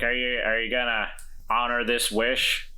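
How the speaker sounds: ordinary talk, nothing marked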